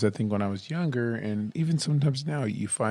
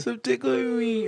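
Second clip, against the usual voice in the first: playful high voice; 12 semitones higher, slower than usual. Pretend. Mock tickling scene.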